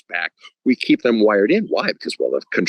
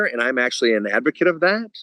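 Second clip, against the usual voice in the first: normal talking voice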